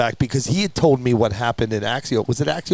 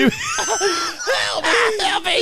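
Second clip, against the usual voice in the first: very high mock plea; tiny victim's cry; much slower than usual. Toy driver on the ground, comic high cry for help. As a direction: squeal like a tiny injured figure, hammed up.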